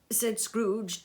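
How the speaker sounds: usual speaking voice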